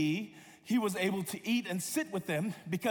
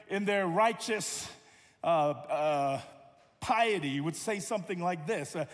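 normal talking voice

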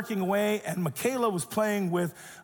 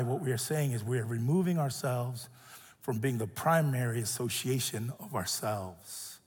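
hushed sermon; 6 semitones lower, 4 dB quieter than usual. Acted. Pastor softly preaching on self-denial, hushed and intimate. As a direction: draw the room in quietly.

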